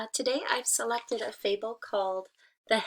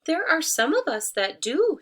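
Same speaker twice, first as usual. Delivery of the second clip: much higher, louder sly tree voice; 8 semitones higher, 7 dB louder than usual. Acted. Disguised wolf answering the hen, high and sly. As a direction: sing it out sweetly, hiding the wolf. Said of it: "said the tree".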